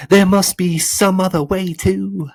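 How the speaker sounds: loud, high-pitched plea; anxious boy Milo. A narrator's reading. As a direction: plead high over the fight.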